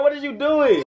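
ordinary talk, nothing marked

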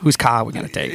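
imitated movie accent with the R dropped in "car"; movie character's accent. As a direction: do his accent, saying "car" like "cah". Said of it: "and that accent".

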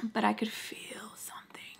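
hushed, unvoiced whisper. Confiding a gut feeling to listeners, whispered. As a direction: whisper it like a secret.

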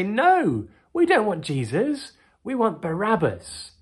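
high, loud mob reply; crowd's answer. Retold or imitated. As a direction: call it out as the stubborn crowd. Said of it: "And they say".

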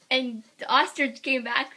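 plain reading voice

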